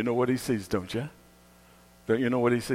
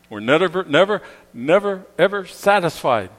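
never, never, never hammered louder; emphatic never; 7 semitones higher, 7 dB louder than usual. Acted. Preacher to the congregation, stressing never, never, never. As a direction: pound each never home. Preacher driving the point home.

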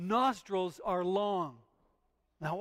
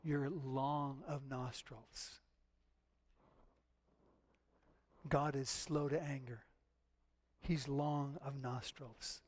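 slow, soft; 7 semitones lower, 7 dB quieter, slower than usual. Real, not acted. Slow emphatic explanation.

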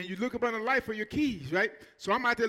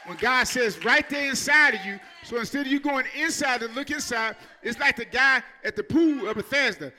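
preaching at full force; 2 semitones higher, 6 dB louder than usual. Acted. Pastor driving home God's point to the church, loud preaching. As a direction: build the sermon to a shout.

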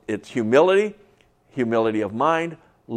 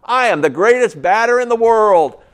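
put-on kid shouting; 7 semitones higher, 7 dB louder than usual. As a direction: yell it to the whole yard like a kid sure of himself. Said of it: "raises his hands up and says".

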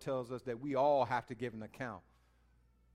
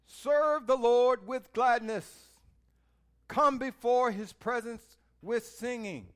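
slow, louder solemn scripture read; 7 semitones higher, 8 dB louder, slower than usual. Acted. Preacher reading a psalm to the congregation, slow and solemn. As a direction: proclaim the verse slowly.

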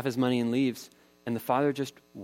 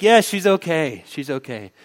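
high, loud reassurance fading down; relieved call; 6 semitones higher, 10 dB louder than usual. Retold or imitated. Father shouting up to his wife that their daughter is safe. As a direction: call out relief, then settle.

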